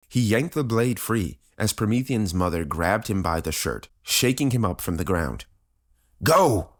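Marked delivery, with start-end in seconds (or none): 6.2-6.7 high, loud shout; one forceful yelled word; father's urgent cry. A narrator's reading. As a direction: cry it out as a desperate order. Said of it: "his father cried".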